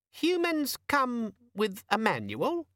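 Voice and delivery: very high, careful gadget voice; very high, slow, hesitant squeaky demon. A narrator's reading. Organizer demon answering Vimes after hesitating. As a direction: sound unsure and small.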